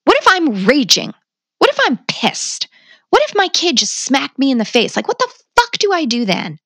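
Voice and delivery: venting at the parenting class, half-acted; retold angry outburst. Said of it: "And I was like".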